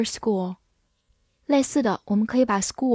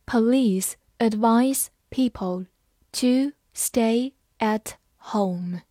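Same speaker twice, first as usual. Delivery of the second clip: slow, each word set apart; slow read-aloud; slower than usual. Shown as a demonstration. Teacher models the police sentence for learners, slowly word by word. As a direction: read it slowly with pauses between words. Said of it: "好，慢慢来读".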